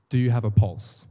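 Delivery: loud, punchy challenge from the pulpit; booming preacher question. Retold or imitated. Preacher loudly quizzing a nervous volunteer, booming. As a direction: demand it like a preacher daring the crowd.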